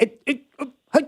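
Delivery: imitating failed attempts to get a word in; short, broken stabs at speaking; mock stammer, cut off each time; trying to interject. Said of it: "I'm kinda like".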